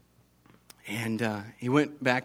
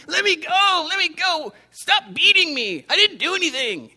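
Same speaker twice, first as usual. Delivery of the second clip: put-on much higher, loud, desperate child's scream; screaming boy; 5 dB louder than usual. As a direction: shriek to be let go, like a caught boy. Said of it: "the boy was screaming".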